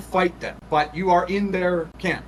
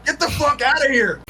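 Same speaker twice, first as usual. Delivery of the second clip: high, urgent character yell; high-pitched, forceful shout at moderate volume; acted yell; 10 semitones higher than usual. A narrator's reading. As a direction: yell a warning to a friend. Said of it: "Lucas is also yelling".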